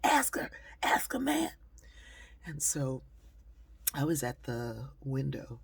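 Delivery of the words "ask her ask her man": high, loud egging-on call. Retold or imitated. Men in the corner egging their friend on, loud call. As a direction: urge your buddy on from across the room.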